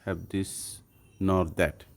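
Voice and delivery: very slow, dictating word by word; careful slow teacher reading; slow example sentence. Shown as a demonstration. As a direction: read it slowly for the class. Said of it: "for example".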